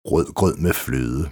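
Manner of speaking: "Rød grød med fløde" slowly, every D soft like th; slow, careful soft Ds in "Rød grød med fløde"; soft-D tongue twister. Shown as a demonstration. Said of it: "som indeholder bløde D'er". (langsomt blødt D i Rød grød med fløde)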